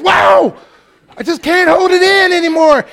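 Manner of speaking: loud, high cry of being hit by the Spirit; mock rapture. Preacher mocking a shopper overcome in the produce aisle, loud and high. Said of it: "if the Spirit hits you at Safeway".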